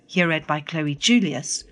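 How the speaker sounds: ordinary delivery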